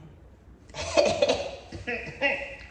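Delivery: breathy chuckle; breathy laugh. Real, not acted. Laughs while unboxing.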